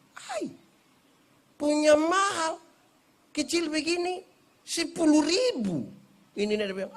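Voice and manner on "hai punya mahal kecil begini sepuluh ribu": high, slow astonished complaint about the price; mock shocked haggler. Buyer acting shocked at grandma's coconut price, high and slow. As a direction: act stunned at the price.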